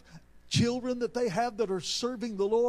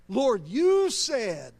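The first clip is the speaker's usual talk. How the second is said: full-force preaching; 8 dB louder than usual. Real, not acted. Preacher reminds God of his promises, fervent and loud. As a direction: cry out the promise from the pulpit.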